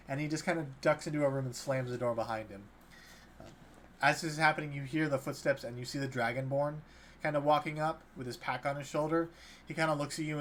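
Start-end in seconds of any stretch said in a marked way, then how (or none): none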